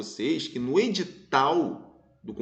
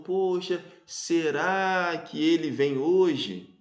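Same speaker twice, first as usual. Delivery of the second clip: slow, drawn-out wondering aloud; lingering, speculative question to oneself; musing, wondering; slower than usual. Shown as a demonstration. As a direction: drift off into a hypothetical, very slowly. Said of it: "naquele mundo da hipótese, né, da divagação".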